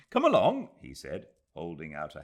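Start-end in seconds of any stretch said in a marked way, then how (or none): 0.1-0.8 much higher and louder, calling out; cheerful call. Acted. Aziz calling the ladies to the caves, cheerful. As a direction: wave them on brightly.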